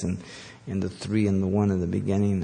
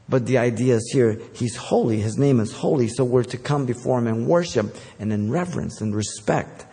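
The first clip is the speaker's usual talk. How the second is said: normal talking voice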